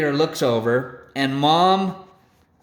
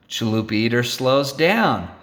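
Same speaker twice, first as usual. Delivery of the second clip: ordinary delivery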